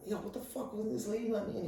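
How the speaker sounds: high, hushed mock outrage; retold complaint in a high, soft voice; indignant protest. Telling friend off about the nurse shutting him out, indignant.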